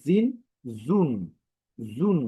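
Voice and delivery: ordinary delivery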